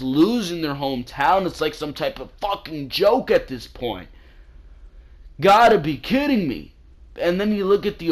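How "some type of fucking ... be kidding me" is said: exasperated. Real, not acted. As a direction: throw it out in disbelief. Exasperated rant aside.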